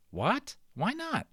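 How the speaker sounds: high startled question; a touch of boyish shock; surprised. Acted. Boy asks Little Bear why not, high and surprised.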